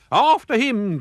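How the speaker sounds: high, loud chase cry; pirates' cry. A narrator's reading. Pirates chase after the dog, loud high cry. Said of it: "cried the pirates".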